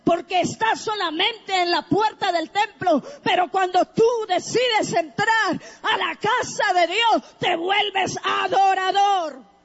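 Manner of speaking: shouted, impassioned preacher delivery; fervent preaching. Real, not acted. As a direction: preach it high and forceful to the congregation. Preaches at full force.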